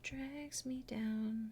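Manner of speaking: whisper. Real, not acted. Whispered aside while drawing.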